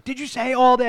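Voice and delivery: high, loud interrogation; imitated accusing yell; mock screaming teacher. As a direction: demand it like a furious teacher. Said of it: "screaming at him".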